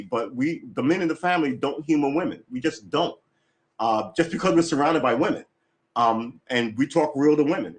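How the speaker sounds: normal talking voice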